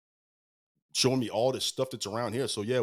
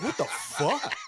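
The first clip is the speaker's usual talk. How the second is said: laughing disbelief; 11 semitones higher, much slower than usual. Retold or imitated. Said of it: "It's like".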